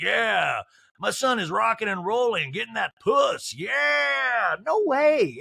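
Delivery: a hammed-up parent bragging, rising to a shout; mock proud parent. Mock parent bragging about her son, hammed-up and shouty. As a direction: play a parent gushing over a son, half yelling. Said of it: "no parent wants to be like".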